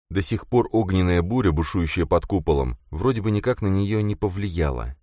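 usual speaking voice

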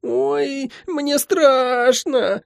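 put-on very high, drawn-out child's whine; squeaky, slow, whimpering complaint; scared whine. A narrator's reading. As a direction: whine like a frightened little boy. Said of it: "немедля заныл братик".

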